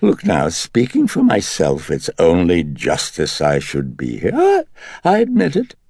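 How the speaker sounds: high, loud wheedling dandy. A narrator's reading. The Dennings personality wheedling at Karras to let it stay, high and loud. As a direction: coax him, all charm and grievance. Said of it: "it wheedled".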